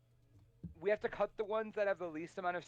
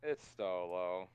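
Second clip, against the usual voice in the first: low drawn-out echo; 10 semitones lower, slower than usual. Acted. Mocking repeat of the line.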